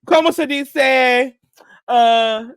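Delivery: loud, high, drawn-out Spanish accent; big slow wind-up into a Spanish accent, then stall; attempted Spanish accent. Shown as a demonstration. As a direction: stretch out a hammed-up Spanish-accent question. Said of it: "say it with the accent".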